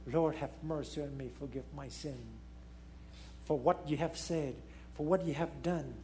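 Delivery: soft, low plea for forgiveness; hushed pleading prayer. Retold or imitated. A sinner quietly begging the Lord for mercy at day's end, soft and low. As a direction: murmur the confession quietly. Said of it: "you say".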